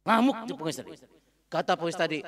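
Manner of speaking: ordinary talk, nothing marked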